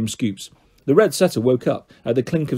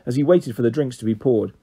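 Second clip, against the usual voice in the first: ordinary delivery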